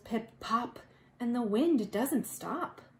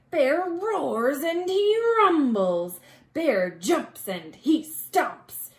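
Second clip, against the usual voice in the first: louder, growling read of the bear's rage; rumbling angry narration; 8 dB louder, slower than usual. A narrator's reading. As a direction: let the bear's fury rumble through. Angry bear wakes.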